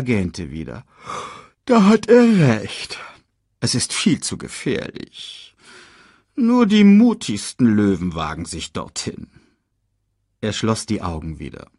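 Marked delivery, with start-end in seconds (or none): 1.6-9.3 theatrical warning that starts high and loud, then drops; sly lion uncle. Acted. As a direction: bait the cub with mock concern. Reads Scar slyly baiting Simba.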